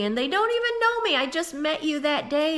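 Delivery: sudden high, hurt indignant whine. Retold or imitated. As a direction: squeak it out, wounded and outraged. Hurt outrage at strangers' dislike.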